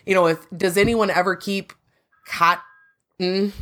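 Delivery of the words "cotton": COTTON broken in two with a held N; self-conscious COTTON. Real, not acted. Co-host asks about storing it at home, careful COTTON. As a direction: say COTT, then a drawn-out N, carefully. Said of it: "now I know I'm saying it wrong".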